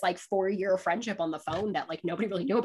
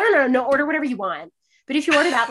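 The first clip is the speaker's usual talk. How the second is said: higher, louder, quick denial; mock protesting innocence; 5 semitones higher, 5 dB louder, faster than usual. Friend pretending not to care what she orders, protesting. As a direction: imitate someone too eagerly waving it away.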